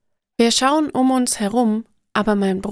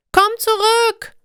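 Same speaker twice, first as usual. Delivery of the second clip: much higher, louder desperate call; 12 semitones higher, 5 dB louder than usual. A narrator's reading. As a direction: call after the friend leaving you in the hole. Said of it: "rufe ich". (verzweifelter Ruf, viel höher und lauter)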